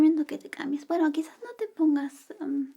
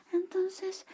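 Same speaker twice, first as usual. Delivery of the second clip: ordinary talk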